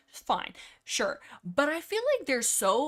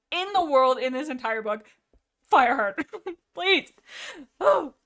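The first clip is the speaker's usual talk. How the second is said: louder, higher shout at Fireheart; exasperated shout; 5 semitones higher, 8 dB louder than usual. Real, not acted. Streamer scolding Fireheart the character, loud laughing shout. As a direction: yell at him to stop, half laughing.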